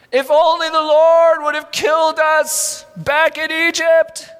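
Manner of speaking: high, slow, loud whiny moan. Acted. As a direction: moan it like a complaining crowd. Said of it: "they moaned".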